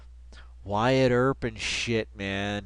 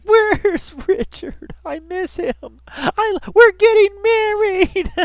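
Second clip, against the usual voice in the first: much higher, slow mock girly voice; lovesick daughter; 6 dB louder, slower than usual. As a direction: swoon over him like a smitten teen daughter. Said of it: "your daughter would be like".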